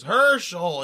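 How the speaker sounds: imitated burst of recognition; sudden mock-delighted exclamation; bugging out. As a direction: call out the old friend's name, bugging out. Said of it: "And he bugs out".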